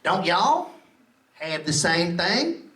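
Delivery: put-on lazy southern girl, slow and low; slow Mississippi drawl. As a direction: drag every word out like a sleepy Mississippi local. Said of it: "She goes like this".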